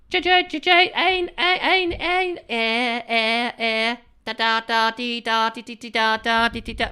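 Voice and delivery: high, loud beeping Morse syllables; Morse code beeps. Pretend. Host acts out tapping Morse code, high beeps. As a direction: beep like a telegraph key.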